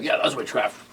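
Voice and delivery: hushed, lightly acted movie line; quietly imitating a character; mimicked solemn warning. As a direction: drop the volume for a mock-ominous line. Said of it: "they're like, they're like".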